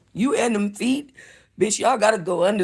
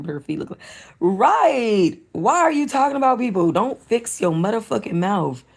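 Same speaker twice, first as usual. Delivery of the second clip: usual speaking voice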